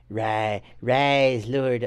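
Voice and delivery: put-on low, half-hearted Trump accent; failed Trump accent. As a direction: attempt a Trump accent and give up. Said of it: "I can't even do a Trump accent".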